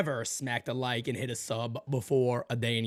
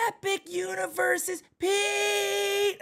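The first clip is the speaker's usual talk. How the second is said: very high, slow mysterious call; beckoning voice; much slower than usual. Acted. Store calls to him as he walks, high slow beckoning voice. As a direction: stretch it out in a high, eerie summons. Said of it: "what is that noise".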